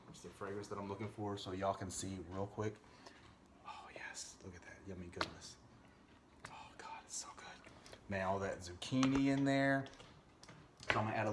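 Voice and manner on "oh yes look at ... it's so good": hushed, whispered relish; savoring whisper. Real, not acted. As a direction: whisper in delight over the pot. Savoring the food in an undertone.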